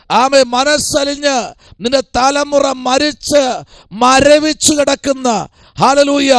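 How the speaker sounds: high, driving pulpit cadence with drawn-out words; fervent preaching. Real, not acted. Preacher describes Jesus stopping the funeral bier, fervent preaching. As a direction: pile up phrase on phrase at full sermon pitch.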